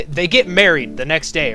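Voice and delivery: usual speaking voice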